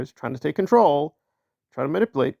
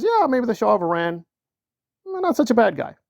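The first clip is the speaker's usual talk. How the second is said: casual mock; 6 semitones higher than usual.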